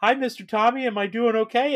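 very high, loud, eager voice; mock Jeremy. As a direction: beam it up high at Tommy. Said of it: "he's like".

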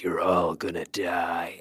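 low, slow doom-laden voice; Lance Henriksen. Acted. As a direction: intone it low and drawn out, all gravitas. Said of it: "get Lance Henriksen to come on set".

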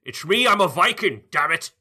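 hammed-up Viking insisting loudly; mock Viking accent. Cohost insists he is a Viking, loud hammed-up accent. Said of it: "as Sean Connery".

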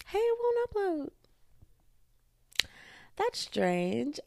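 high, slow mock-puzzled; hammed-up disbelief; mock surprise. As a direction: act out the confused moment.

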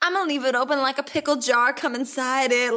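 high, fast mock croon; hammed-up lyric voice. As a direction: oversell a cheesy lyric. Mock song lyric delivery.

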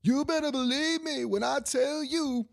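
very high, slow mock-pundit proclamation; hammed-up TV pundit insistence; Stephen A. Smith. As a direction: stretch it out in a high, insistent imitation. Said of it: "that's how he goes".

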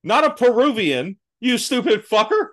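high, loud mock tirade; mock rant. Imagined rant at Leguizamo, high and indignant. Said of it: "And I'm like".

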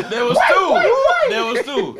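loud, high-pitched insistence; real high, loud protest; shouted protest. Real, not acted. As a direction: shout it out over the laughter. Jokey protest over bone accusation.

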